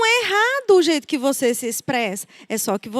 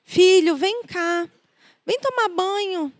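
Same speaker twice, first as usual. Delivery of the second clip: gentle, higher-pitched coaxing; loving mother; 6 semitones higher than usual. Retold or imitated. Mother calling her son to bath and dinner, lovingly. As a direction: call your child over lovingly, softening after the first word. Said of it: "tudo com amor".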